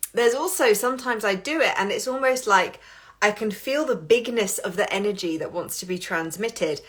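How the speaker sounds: normal talking voice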